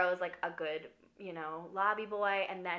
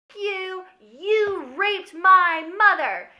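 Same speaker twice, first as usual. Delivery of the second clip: slow, loud, high furious yell; enraged accusation; 10 semitones higher, 14 dB louder, much slower than usual. Retold or imitated. Brody's son accusing Gustav, slow furious yell. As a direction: spit each word out in rage.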